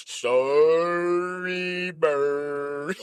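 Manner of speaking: very deep, sung-out apology; Snuffy voice. Pretend. Snuffy apologizing to Big Bird, deep and sung. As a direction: croon it low and long. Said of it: "Snuffy like".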